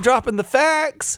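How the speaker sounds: slow, high mock brag; high put-on boast. Co-host bragging about film facts, high mock boast. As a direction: stretch it out high and cocky.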